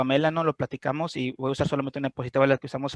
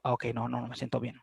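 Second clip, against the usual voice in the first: much softer, lower admission; quiet admission; 4 semitones lower, 8 dB quieter than usual. Acted. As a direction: let it slip out softly. Quiet admission after probing. (confesión en voz baja)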